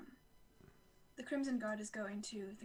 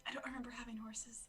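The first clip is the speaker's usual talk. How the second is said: whispering; 7 dB quieter than usual. Acted. Whispered aside.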